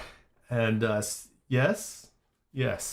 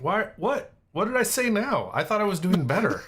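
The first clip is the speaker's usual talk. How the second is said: surprised protest; 8 semitones higher than usual. Real, not acted. Playfully accused, protests innocence.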